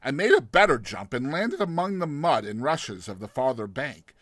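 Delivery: plain reading voice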